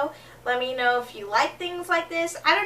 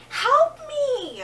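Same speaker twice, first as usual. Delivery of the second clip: much higher, pleading yelp; cry for help; 11 semitones higher than usual. Retold or imitated. Begging the strong guy for help, high pleading yelp. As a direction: squeal it desperately.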